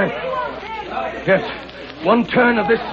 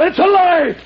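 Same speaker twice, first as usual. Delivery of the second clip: angry denial; 7 semitones higher, 3 dB louder than usual. Acted. Maxim denies accusation.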